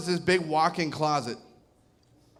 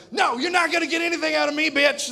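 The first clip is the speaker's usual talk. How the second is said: fast, high mock-tough refusal; hammed-up prisoner refusing to break; mock interrogation defiance, high and quick; defiant captive; 8 semitones higher, faster than usual. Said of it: "all my training as a U S Marine".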